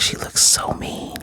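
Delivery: slow hushed whisper, stretching so. Real, not acted. Whispering to viewers how mean the wife looks. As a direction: breathe it slowly like a secret. Whispered then repeated aloud.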